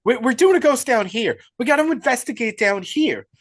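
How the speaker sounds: high, loud, panicky protest; hammed-up frightened whine; mock panic. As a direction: imitate a scared streamer refusing to go upstairs. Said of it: "And he panics, bro".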